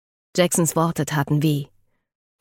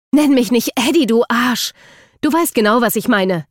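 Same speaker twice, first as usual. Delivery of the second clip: loud, high, heated rebuke; angry outburst; 6 semitones higher, 5 dB louder than usual. A narrator's reading. Eden rebukes Luke for playing innocent, angrily loud. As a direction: lash out at him for the nickname. Acted quarrel, insulting Luke.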